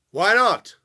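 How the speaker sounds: loud, high, pushy why not; insistent question. Retold or imitated. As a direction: demand an answer, loudly. Viennese strangers pressing him.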